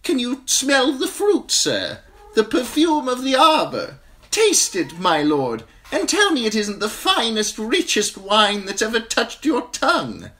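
high, bright merchant voice; oily wine seller charm; wine seller, smiling. A narrator's reading. Wine seller pushing poisoned wine.

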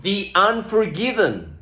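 "The unforgiven" with "the" as "thee", slowly; "the" before a vowel, slow. Shown as a demonstration. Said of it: "Si empieza por vocal, decimos the".

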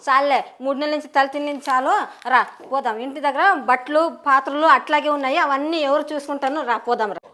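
loud, exasperated wife ordering him home; fed-up scolding. Acted. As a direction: snap at him and drag him out. Wife drags husband home, scolding. (విసుగుతో గట్టిగా ఇంటికి పిలిచే భార్య)

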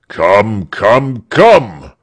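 peremptory, escalating to a loud last word; impatient command. Acted. Impatient governor orders his soldiers.

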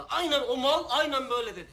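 loud, heated outburst; angry scorn. Acted. Quoting the old captain to the crew, angry scorn. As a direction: throw it out with loud contempt. Mocking what old man said.

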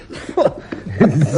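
soft amused chuckle; a quiet laugh at his own joke. Acted.

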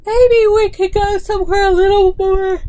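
talking through a big acted yawn; high, stretched-out yawny voice. A narrator's reading. Tired Lucy asking Granddude to go somewhere, mid-yawn. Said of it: "said Lucy with a yawn".

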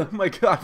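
sharp loud cough then groan; loud pained cough. Real, not acted. Gamer hurt by his own move, coughing loudly. Pain from action.

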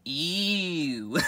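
high ew breaking into a laugh; disgusted laugh. Acted. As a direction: gross out, then cackle.

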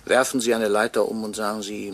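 usual speaking voice

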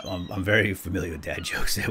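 ordinary talk

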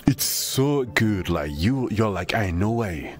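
ordinary talk, nothing marked

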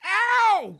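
loud high ouch; pained cry. Acted. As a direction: yelp in sudden pain. Ted hurt by skin pull.

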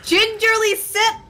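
climbing high and loud; mock high voice. Mocking repeat, rising high.

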